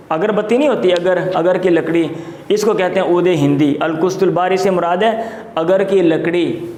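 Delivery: ordinary delivery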